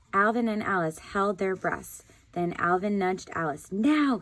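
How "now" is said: loud, high urgent call; cry out. A narrator's reading. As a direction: shout the signal to go. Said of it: "he cried".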